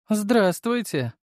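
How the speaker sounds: медленно и высоко, с улыбкой; тянет приветствие, улыбаясь; с улыбкой, протяжно. Acted. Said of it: "улыбнулась Долгорукова". (медленно, с улыбкой)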